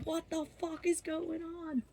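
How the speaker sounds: high, held-back mock scream; imitated screaming. Raving friend aggressively not functioning, mock scream. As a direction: scream it in miniature, high and soft. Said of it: "just kept screaming".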